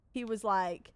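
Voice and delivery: normal talking voice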